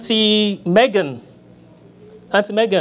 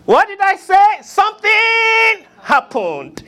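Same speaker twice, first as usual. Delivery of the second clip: drawn-out rousing shout; preacher shout; 12 semitones higher, slower than usual. Retold or imitated. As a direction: belt it like a Pentecostal preacher. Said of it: "Pentecostal preachers".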